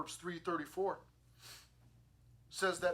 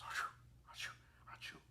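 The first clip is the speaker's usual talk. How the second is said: soft, whispered little sneezes; mock sneezes; 10 dB quieter, faster than usual. Preacher imitating the child's sneezes, softly whispered.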